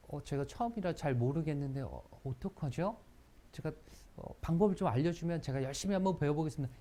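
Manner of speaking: soft, humble request to learn; humble eager. Shown as a demonstration. Teacher shows a humble newcomer asking help, humble. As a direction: ask politely, eager to learn. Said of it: "이렇게 하는 거랑".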